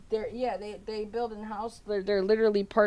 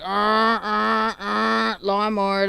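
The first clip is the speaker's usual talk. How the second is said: droning engine noise, louder; mocking lawnmower noise; 3 semitones higher, 6 dB louder, much slower than usual. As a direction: imitate a lawnmower to mock someone. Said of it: "That's what you sound like".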